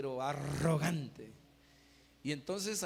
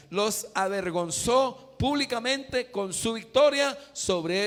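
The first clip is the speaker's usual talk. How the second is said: loud, high, emphatic sermon delivery; full-force preaching; 6 semitones higher, 10 dB louder than usual. Real, not acted. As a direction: proclaim each phrase to the congregation, stretching key words.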